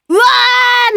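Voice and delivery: high, drawn-out shout of realisation; loud "uwaa" outcry. Real, not acted. Host finding out the real sender, a high long "uwaa". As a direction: cry out as the trick is revealed. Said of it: "メールをうわーっていうの失礼かな".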